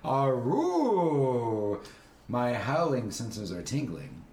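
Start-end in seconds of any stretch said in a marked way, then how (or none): 0.0-1.8 loud drawn-out aroo howl; wolf howl. Acted. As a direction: answer with a playful howl. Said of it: "What does it sound like".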